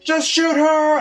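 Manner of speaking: hammed-up gamer shouting orders; replaying a frantic yell at the TV; mock yell. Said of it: "I was, like, yelling at it".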